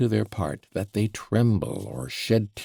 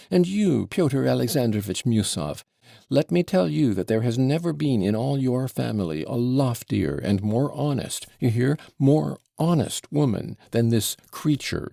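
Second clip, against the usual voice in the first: spluttering rant; 5 semitones higher than usual. Acted. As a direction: rail at him, spluttering. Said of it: "breathless and spluttering".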